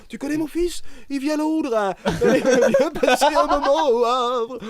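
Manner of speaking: mock character voice. Mock voice, laughing.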